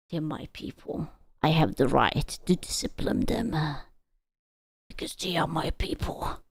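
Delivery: low, hushed and deliberate; quiet mock menace. Streamer claiming the right to discipline own people, quiet mock menace. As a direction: drop to a soft, low mutter.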